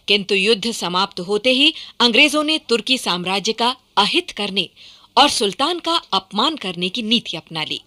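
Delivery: normal talking voice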